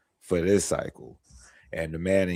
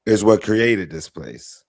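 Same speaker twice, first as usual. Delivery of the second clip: tipsy; 3 semitones higher, 5 dB louder than usual. Real, not acted. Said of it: "you've been hitting that tequila".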